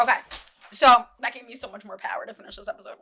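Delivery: ordinary delivery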